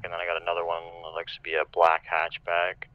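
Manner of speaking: ordinary delivery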